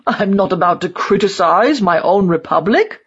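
put-on indignant old loyalist, voice rising at the end; old man's character voice, offended; disgusted refusal. A narrator's reading. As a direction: refuse as an affronted old die-hard. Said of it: "in disgust".

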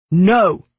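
loud, firm refusal. A narrator's reading.

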